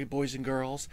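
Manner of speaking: ordinary talk, nothing marked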